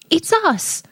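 put-on high little-boy voice; small boy. A narrator's reading. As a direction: pipe up like a tiny boy. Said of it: "said Harry".

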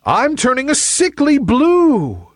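high, panicked wail; acted high-pitched distress; alarmed little cloud. A narrator's reading. Nimbus panics at changing colour.

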